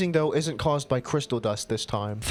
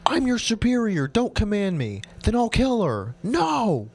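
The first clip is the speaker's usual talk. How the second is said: heated shouting; 9 semitones higher than usual. Acted. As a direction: shout him down in the standoff. Heated standoff, shouting back and forth.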